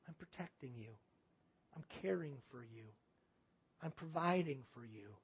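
low, very soft, tender voice; hushed reassurance. Acted. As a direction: comfort softly, almost under the breath. Voicing God's answer in prayer.

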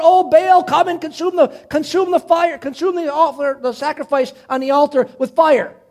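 high retold plea to a god; crying out. False prophets begging Baal for fire, crying out. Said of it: "they're crying out and crying out".

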